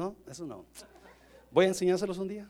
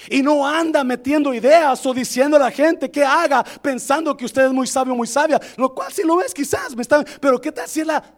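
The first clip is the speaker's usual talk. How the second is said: loud, high pulpit exhortation; insistent raised-voice admonishing; forceful preaching; 8 semitones higher, 10 dB louder than usual. Real, not acted. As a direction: drive the warning home to the congregation. Exhorts congregation at full force.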